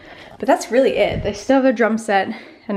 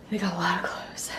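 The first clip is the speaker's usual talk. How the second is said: whisper; 3 semitones lower, 9 dB quieter than usual. Real, not acted. Whispered aside.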